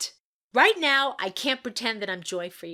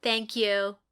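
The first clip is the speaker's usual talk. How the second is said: ordinary delivery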